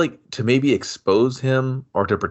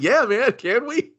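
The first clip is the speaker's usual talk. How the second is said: mock eager reply; 11 semitones higher than usual. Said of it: "mocking his own eager reply".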